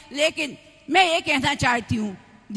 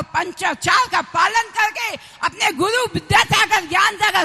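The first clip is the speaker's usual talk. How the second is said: raised preaching voice with hammered key words; fervent exhortation; 3 semitones higher, 6 dB louder than usual. Real, not acted. Nun urging followers to form a team for initiation, fervent exhortation. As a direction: urge the crowd on, calling out each appeal.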